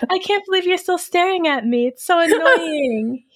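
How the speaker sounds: high, silly voiced dog. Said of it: "as I do the dog voice".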